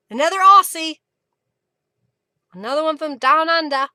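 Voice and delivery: playful put-on Aussie accent; fake Australian accent. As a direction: say it like a mock Australian. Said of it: "by my fake accent".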